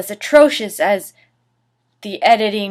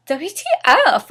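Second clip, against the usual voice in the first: mock angry shout; 7 semitones higher, 4 dB louder than usual. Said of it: "I shouted angrily".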